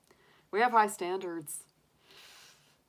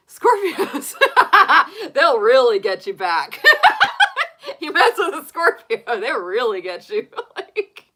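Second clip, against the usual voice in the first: loud, high-pitched real laughter through the words; laughing; 10 semitones higher, 14 dB louder than usual. Real, not acted. Joking to viewers about vengeful Scorpios, laughing hard. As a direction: crack up while talking, voice leaping up.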